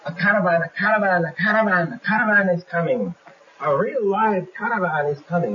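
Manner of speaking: high, loud cartoon yell of a lookout; screaming brigand. Sergeant Hook screams the caravan news to the brigands, high and loud. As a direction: shriek the news like a frantic sentry.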